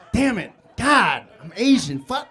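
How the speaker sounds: loud, hammed-up exasperation; frustrated outburst. Teenager reacting to the pat-down, mock frustrated cursing. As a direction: curse your luck in loud bursts. Acted dismay during pat-down.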